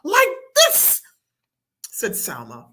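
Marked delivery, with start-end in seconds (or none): 0.0-1.0 put-on very high, loud girlish cry; scary demonstration. A narrator's reading. Salma demonstrates scaring.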